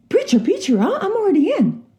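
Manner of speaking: very high, protesting put-on boy voice; hammed-up youngster talking his way out; eighteen-year-old boy. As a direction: plead with the preacher in a squeaky pitch. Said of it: "eighteen-year-old boy by the neck".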